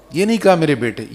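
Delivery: ordinary talk, nothing marked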